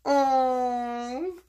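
ordinary delivery

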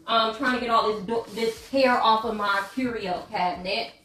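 ordinary talk, nothing marked

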